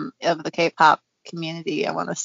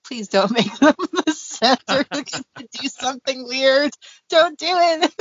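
anxious pleading fan; 5 semitones higher than usual. Retold or imitated. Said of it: "I'm like".

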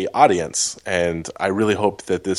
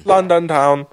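put-on British accent; 10 semitones higher than usual. Mocking London accent banter.

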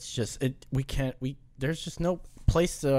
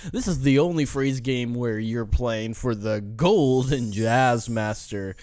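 slow low game show announcer; game show host; 5 semitones lower than usual. Acted.

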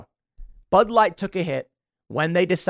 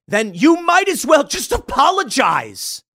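higher, louder and slow, shouting it out; emphatic shout; 10 semitones higher, 5 dB louder, slower than usual. Real, not acted. Telling Bud Light what to do, in a slow shout. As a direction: build to a slow shouted demand.

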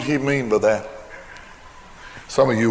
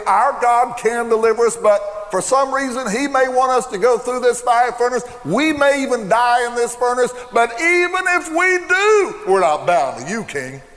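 preaching at full force; 10 semitones higher than usual. Acted. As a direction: preach it out with fire.

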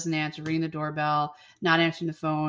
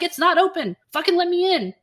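higher and louder mock insistence; mock demanding yell; 11 semitones higher, 5 dB louder than usual. Bridget demands Daniel let her in, high mock yell. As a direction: push to be let in, high and loud.

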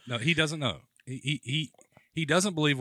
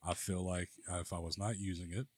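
low, soft sultry baritone; sultry narrator; 4 semitones lower, 9 dB quieter than usual. Pretend. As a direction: drop into a soft, low croon. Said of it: "God blessed me with this sultry baritone voice".

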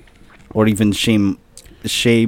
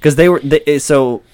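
ordinary delivery

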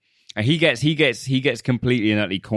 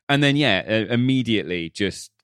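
normal talking voice

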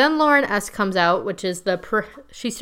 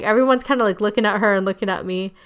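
normal talking voice

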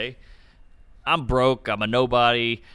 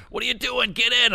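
much higher mock call from a van; 10 semitones higher than usual. Comic imitating men calling from a minivan, much higher. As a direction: bark the invitation in a comic high voice. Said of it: "they would be like".

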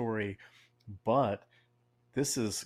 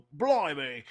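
a quick mock Cockney "blimey", a bit higher and louder; 5 semitones higher, 5 dB louder than usual. Cohost jokes founding fathers spoke Cockney, mock Cockney. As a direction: toss off "blimey" in a jokey Cockney accent. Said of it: "Speaking Cockney".

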